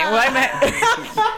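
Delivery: high, very slow words through laughter; giggling at how silly it got; laughing disbelief. Real, not acted. Laughing at the absurd exchange.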